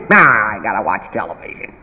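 put-on low dismissive kid voice; child brushing off parent, low and bored; lazy kid.

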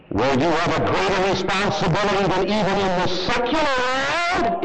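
high, ringing pulpit preaching; fiery revival preaching; full-force preaching. Acted. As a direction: hammer the point from the pulpit.

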